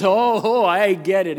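loud, high acted dawning understanding; sudden realization. Store worker learns he's a pastor, acted realization. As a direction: burst out with it as it clicks.

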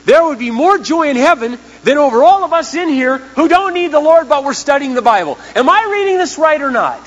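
impassioned revival preaching; full-force preaching. Real, not acted. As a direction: preach it out to the back row.

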